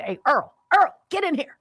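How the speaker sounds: high, loud acted summons; calling out. Imagined CNN staffer calling Earl into the room, calling out. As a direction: call a colleague in, pitch leaping up. Acts newsroom boss calling a colleague.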